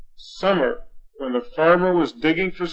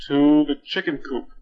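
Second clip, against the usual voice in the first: ordinary delivery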